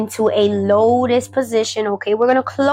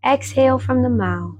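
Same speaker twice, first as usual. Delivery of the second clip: slow, gentle breathing guidance; guided meditation; slower than usual. Acted.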